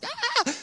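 very high, small mock scream; comic high squeal of panic; panicked yelp. Pastor recalls panic at flood.

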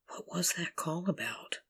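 breathless whisper to herself; barely voiced, wondering who called. Alone after a strange phone call, whispering.

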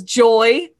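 a loud, punched "Joy"; emphatic joy. Retold or imitated. Spencer answering Carly about solitaire, loud and emphatic. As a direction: bark out "Joy" like it's obvious. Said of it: "Spencer says".

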